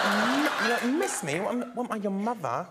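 outraged Towie girl, hammed up; Towie accent. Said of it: "and I'm like".

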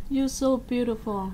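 slow, hushed, like he has lost his mind; a hint of a spellbound stranger; dazed, out of his mind. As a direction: breathe it out in a daze. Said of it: "giống như kiểu như là bị mất trí luôn á".

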